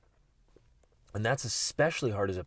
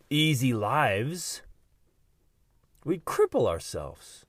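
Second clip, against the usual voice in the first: slow, louder, drawn out; emphatic counsel; 5 semitones higher, 5 dB louder, slower than usual. Acted. Speaker urging listeners to flip their prayer, slow emphatic. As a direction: press each word home.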